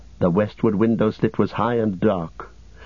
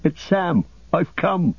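tearful cry; 8 semitones higher than usual. Acted. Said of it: "tears almost blinding him".

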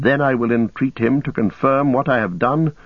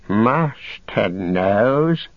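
very slow, sly creature whisper-whine; Gollum, sly; much slower than usual. Acted. Captive slyly deferring to his master, very slow.

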